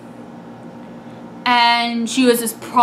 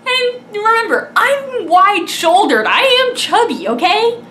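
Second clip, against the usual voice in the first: pleading squeak, much higher; high-pitched plea; 9 semitones higher than usual. Real, not acted. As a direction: voice jumps much higher, half-crying. Self-conscious aside about body.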